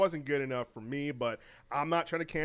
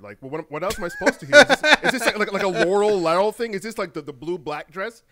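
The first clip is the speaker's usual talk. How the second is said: high, loud disbelieving rant; incredulous mock disbelief; 5 semitones higher, 12 dB louder than usual. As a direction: scoff at it, voice jumping up. Friends mocking the excuse.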